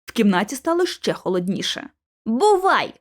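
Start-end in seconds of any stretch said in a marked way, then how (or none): 2.3-2.9 short, higher-pitched call; exclaiming. A narrator's reading. George calling out at the way out, exclaiming. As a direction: call it out at the exit. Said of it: "вигукнув Джордж біля виходу".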